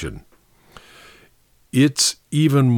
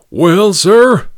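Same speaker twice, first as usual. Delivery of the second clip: loud, higher booming outburst; thundered; 8 semitones higher, 9 dB louder than usual. A narrator's reading. Patch booming indignantly at Ellery, thundering loud. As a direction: thunder it out like an offended giant. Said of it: "thundered Mister Patch".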